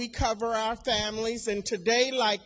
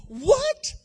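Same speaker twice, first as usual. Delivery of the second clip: stunned what; 13 semitones higher than usual. Retold or imitated. Stunned reaction to son's death.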